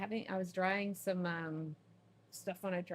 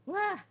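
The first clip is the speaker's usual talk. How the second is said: startled yelp; 13 semitones higher, 6 dB louder than usual. Real, not acted. Startled cry during cat story.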